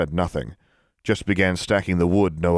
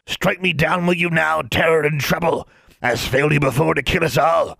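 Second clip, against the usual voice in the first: higher, archaic defiant dwarf; higher, louder dwarf defiance; indignant dwarf; 10 semitones higher, 4 dB louder than usual. A narrator's reading. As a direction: hurl it at the witch.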